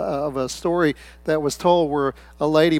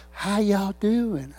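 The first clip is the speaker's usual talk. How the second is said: slow, drawn-out, higher churchgoer greeting; put-on church voice; 4 semitones higher, 4 dB quieter, much slower than usual. Churchgoer greeting people on arriving at church, put-on voice. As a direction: imitate someone putting on a church voice. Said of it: "they talked different than when they were outside".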